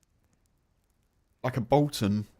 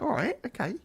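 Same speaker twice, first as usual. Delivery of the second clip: mock reaction; 10 semitones higher than usual. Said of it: "I'm like".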